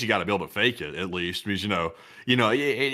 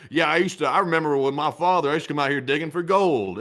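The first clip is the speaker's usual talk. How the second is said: put-on folksy Western accent, a bit higher; mock Western accent; 3 semitones higher than usual. Politician out west claiming a gold-digging past, mock Western accent. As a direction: play a phony Western prospector accent. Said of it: "they go out west".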